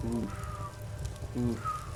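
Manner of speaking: ordinary delivery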